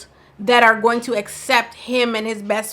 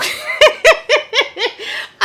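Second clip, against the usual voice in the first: shrieking laugh; 14 semitones higher, 4 dB louder than usual. Real, not acted. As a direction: burst out in a high, loud laugh. Reacting to Chelsea's "I do.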